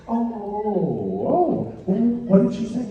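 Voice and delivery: high, swooping dismayed dad; mock parental shock. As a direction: draw out the oh's in exaggerated concern. Dad's mock shock in story.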